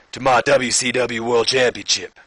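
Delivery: put-on wrestler promo voice; botched impression veering into Stone Cold; Booker T. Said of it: "This is really Stone Cold".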